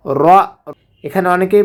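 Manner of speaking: usual speaking voice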